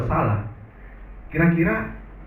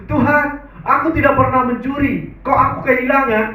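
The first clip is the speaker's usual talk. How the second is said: higher and louder, pleading prayer; emphatic prayer; 8 semitones higher, 3 dB louder than usual. Retold or imitated. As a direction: lift the prayer up high and press it. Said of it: "Dia bilang begitu".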